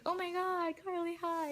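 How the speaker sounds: high-pitched fangirl hello; excited fan greeting. Retold or imitated. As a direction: imitate a thrilled fan meeting an idol. Retold fan greeting idol.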